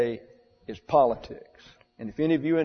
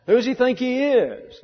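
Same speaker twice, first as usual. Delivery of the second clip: high, louder indignant sneer; indignant Pharisee; 11 semitones higher, 5 dB louder than usual. Retold or imitated. Preacher voicing Pharisees outraged at the Nazarene, indignant high sneer. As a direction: scoff like an outraged religious leader. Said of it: "all these people go nuts".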